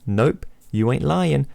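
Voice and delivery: slow, casual slang reply; slow drawn reply. Acted. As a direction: stretch it out lazily. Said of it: "you reply".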